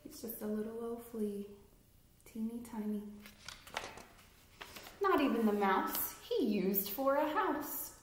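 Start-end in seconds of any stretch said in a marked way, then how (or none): none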